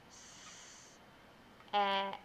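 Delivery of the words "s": S hissed alone, unvoiced; isolated S sound. Shown as a demonstration. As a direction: hiss the S sound by itself. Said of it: "write my letters".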